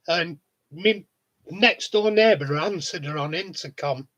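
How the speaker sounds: ordinary delivery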